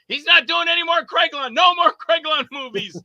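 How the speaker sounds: high, loud imitated scolding; indignant wife. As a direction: lay down the law, imitating an upset wife. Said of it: "My wife was taking it way too serious".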